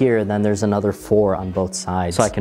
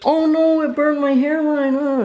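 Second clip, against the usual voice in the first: sudden high alarmed cry. Real, not acted. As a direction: shriek in panic. Sudden alarm at burned hairline.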